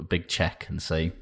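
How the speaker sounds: normal talking voice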